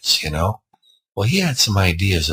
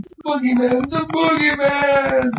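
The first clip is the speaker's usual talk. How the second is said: high, drawn-out terrified scream; screaming child; slower than usual. Acted. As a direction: scream the name, slowing on the last. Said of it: "he'd start screaming".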